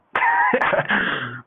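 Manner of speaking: loud high laugh bursting out; a real burst of laughter at himself. Real, not acted. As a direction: crack up laughing mid-story. Laughing at own answer to cop.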